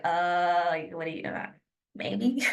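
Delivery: soft, halting mumbled maybe; uncertain prospect. Acted. Prospect caught off guard by the request, uncertain. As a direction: hesitate with fillers, unsure and quiet. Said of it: "they're gonna be like".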